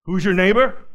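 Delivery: "Who's your neighbor?" fired off loud and challenging, the wrong way to ask; harsh demanding tone. Shown as a demonstration. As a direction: bark the question like a cross-examiner. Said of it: "I know Jesus didn't say it like that".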